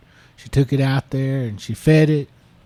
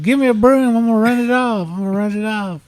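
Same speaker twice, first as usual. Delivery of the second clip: higher, louder, eager to chase it off; excited retold shout; 9 semitones higher, 4 dB louder, faster than usual. Boyfriend tells girlfriend he'll chase the skunk off, raised excited voice. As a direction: play the boyfriend grabbing a broom, fired up.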